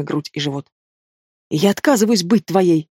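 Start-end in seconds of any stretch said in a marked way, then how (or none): none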